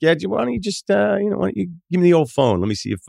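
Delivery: high, put-on pleading voice; overly polite high-pitched plea; mock sweet asking. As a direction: ask for the phone in a falsely nice high voice. Mock request for the phone.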